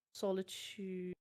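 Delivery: ordinary talk, nothing marked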